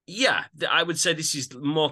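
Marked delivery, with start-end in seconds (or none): none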